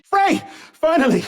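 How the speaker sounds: high, loud exclamation; relieved calling out. Acted. Companion calling to Frey at last, loud relief. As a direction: cry out on finally getting through.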